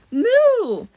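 short high, loud moo; cow moo. Acted. Cow serpent interrupts.

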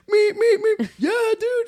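high, loud comic chant of a stranger; hammed-up passerby cheering; mock honking cheer.

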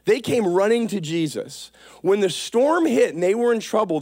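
ordinary talk, nothing marked

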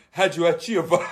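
cracking up on the word; breaking into laughter; amused disbelief; laughing. Real, not acted. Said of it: "Super radio".

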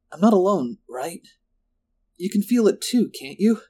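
high, anxious pleading questions; anxious appeal. A narrator's reading. Lindsay asks her friend to confirm the wrongness, anxiously.

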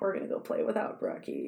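put-on whispered taunt of children. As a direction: breathe it with no voice. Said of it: "they whispered".

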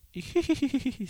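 high, thin ghostly giggle that trails away; eerie giggle. Retold or imitated. Ghost girl giggling as she leaves, eerie. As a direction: giggle like a creepy ghost child. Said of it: "se va riendo".

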